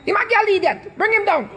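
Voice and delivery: high mock mob voice egging on; mocking online mob. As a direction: jeer like a mob calling for blood. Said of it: "They were like".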